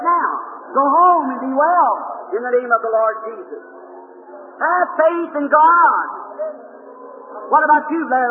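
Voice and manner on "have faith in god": slow, loud, ringing call; preacher's slow ringing call to faith; drawn-out refrain. Real, not acted. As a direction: stretch each word out to the whole hall. Healing service preacher's refrain.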